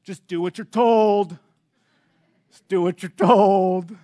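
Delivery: mock exasperated coach, leaning on the last word; Jeremy. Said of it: "he started laughing at me".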